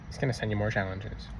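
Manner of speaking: a soft, sinking echo of the warning; quiet repeat. Shown as a demonstration. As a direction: drop the repeat to near a hush.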